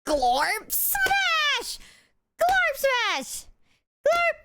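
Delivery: squeaky high monster shout; high cartoon brute voice. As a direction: yell it like a tiny cartoon hulk. Shouting a character's catchphrase.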